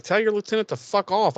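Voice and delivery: high, indignant snap; a hammed-up angry comeback; mock angry retort. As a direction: tell her off with mock outrage. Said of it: "I'd be like".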